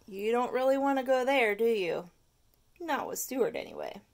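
ordinary delivery, nothing marked

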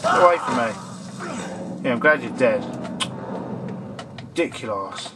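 ordinary talk, nothing marked

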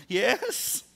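high, caving in through a laugh; laughing confession. Retold or imitated. Husband caving to wife, laughing out yes. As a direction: crack and admit it, giggling.

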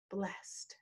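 near-whispered, very soft landing; soft, reverent close; hushed. Real, not acted. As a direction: let the word drop to a whisper.

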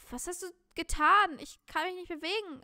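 high, loud accusing cry; acted panic. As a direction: cry out in alarm at being trapped. Figure panics, unable to move.

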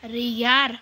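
ordinary talk, nothing marked

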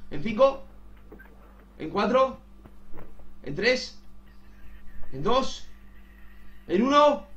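rising countdown pressure; urgent countdown. Real, not acted. Countdown urging Román to answer.

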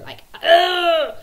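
cri d'exaspération aigu et fort; frustrated ugh. Real, not acted. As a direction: let out a loud, high, fed-up ugh. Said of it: "fuming and frustrated".

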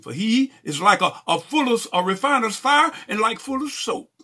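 high, forceful pulpit reading; preached scripture reading. Acted. Preacher reading of the refiner's fire, forceful proclaiming. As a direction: build the verse to a loud, high proclamation.

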